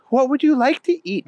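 put-on high, quick little girl question; small high-pitched girl. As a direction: ask quickly in a high child voice. Voices the girl Sushi.

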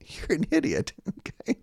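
laughing through a teasing insult. Real, not acted. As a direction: giggle it out, half-joking. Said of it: "in kindness, could I say".

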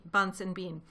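usual speaking voice

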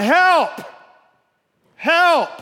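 loud, high acted cry for help; yelling for help. Man hanging from a branch calls for rescue, yelling. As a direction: holler it up the cliff. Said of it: "he's yelling".

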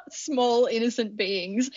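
cracking up mid-sentence; real laughing. Real, not acted. Host pitches predator modes to co-host, breaking up laughing. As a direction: struggle to get the words out through giggles.

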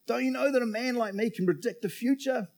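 high, dramatic demand. A narrator's reading. Joseph confronting his brothers in a Bible reading, demanding. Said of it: "Joseph demanded".

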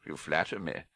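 low, soft, unruffled reply; calm Dumbledore. A narrator's reading. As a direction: accept the compliment quietly. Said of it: "said Dumbledore calmly".

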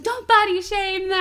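high, loud, outraged mock scolding; mock indignant shout. Man defending his dolls' bodies to her, mock indignant. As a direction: belt out his protest, hammed-up.

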